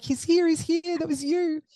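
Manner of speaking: high, tearful delight; joyful amazement. Retold or imitated. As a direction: marvel at the newborn in your arms. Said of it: "I was like".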